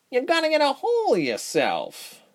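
very high-pitched character voice; high-voiced caution; warning friend. A narrator's reading. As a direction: warn him in a high, squeaky voice. Fox warns Ren, character voice.

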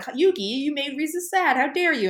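hammed-up accusing shout from the friends; mock scolding. Friends blaming Yugi for Risa, mild mock yell. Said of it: "they're all just screaming at each other".

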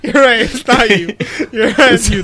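laughing through the apology. Real, not acted. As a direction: chuckle your way through it, caught out.

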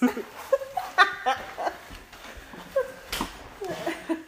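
high-pitched breathy laughing fit; giggling uncontrollably; stoned giggle. Real, not acted. Stoned giggling after the joke.

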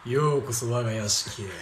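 creepy butler. Acted. S0 becomes Esper Kou-chan.

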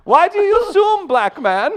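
high, loud put-on protest; offended visitor. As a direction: object as an offended foreign visitor. Visitor challenges New Yorker.

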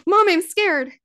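high, loud imitation of a frightened kid; scared child. Mother imitating her scared son on the swing, alarmed. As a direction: call out to Mom in mock alarm. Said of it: "he went".

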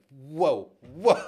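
loud retold astonishment; caught off guard. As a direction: let out a stunned, mock-startled reaction. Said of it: "it just caught me off guard".

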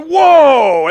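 loud, high mock amazement; imitating onlookers going whoa; amazed crowd gasp. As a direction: cry out like a stunned crowd. Crowd amazed at old man.

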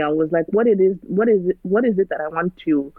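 ordinary talk, nothing marked

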